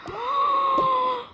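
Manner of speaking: high outraged cry; shocked shout. Acted. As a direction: cry out in dismay. Sisters quarrel over broken palette.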